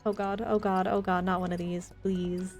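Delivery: ordinary talk, nothing marked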